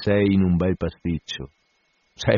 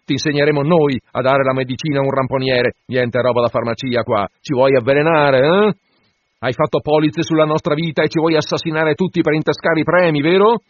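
berating; 5 semitones higher, 5 dB louder than usual. A narrator's reading. Stubb berates the steward.

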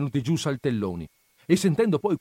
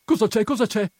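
high, raised-voice questioning; urgent question; 11 semitones higher, 5 dB louder than usual. A narrator's reading. Agnese holding Perpetua back by the skirt, urgent questioning. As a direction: ask it twice in quick alarm. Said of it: "disse Agnese".

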